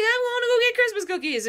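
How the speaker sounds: high-pitched flustered excuse; Ash. Acted. Panicked boy making an excuse to flee, flustered.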